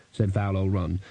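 plain reading voice

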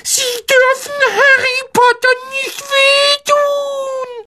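very high, slow protest; squeaky elf crying out to protect a friend; rufender Hauself. A narrator's reading. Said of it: "Doch Dobby rief". (schriller, gedehnter Ruf des Elfen)

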